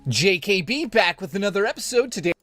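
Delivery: loud hyped show host intro. Shown as a demonstration. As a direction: announce it like a YouTube host. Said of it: "I'm not doing it as crazy as I normally do there".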